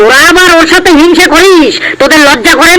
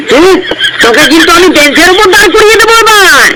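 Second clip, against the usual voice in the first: mock scolding; 3 semitones higher, faster than usual. Teacher scolds the class.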